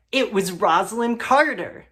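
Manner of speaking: ordinary talk, nothing marked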